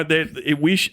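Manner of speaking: usual speaking voice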